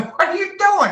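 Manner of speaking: high, loud startled cry; shocked friend at the door; mock alarmed yelp, pitched high; imitating the buddy. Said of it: "He said".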